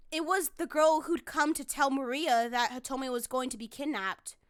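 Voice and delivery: usual speaking voice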